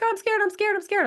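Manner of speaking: high, mock-scared little girl; daughter scared. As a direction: squeak it out like a frightened seven-year-old. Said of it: "she's like".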